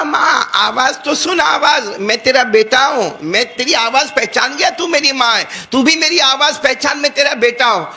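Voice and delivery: اونچی آواز میں منت کرتا بیٹا; pleading son. Retold or imitated. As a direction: beg her to recognise his voice.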